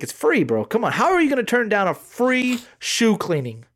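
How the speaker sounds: high, pleading sales pitch; mall kiosk seller. Retold or imitated. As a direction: coax him like a pushy seller. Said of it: "He's like".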